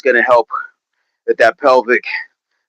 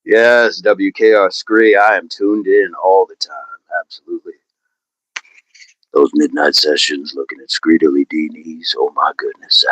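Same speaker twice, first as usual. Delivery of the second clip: radio DJ voice; 8 semitones lower than usual. Acted. Host answering a listener about midnight sessions, low radio DJ voice. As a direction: drop it deep like a smooth radio host. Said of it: "W K R P in Cincinnati voice".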